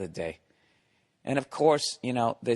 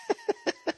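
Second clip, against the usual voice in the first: high squeaky laugh. Real, not acted. Laughs at own joke.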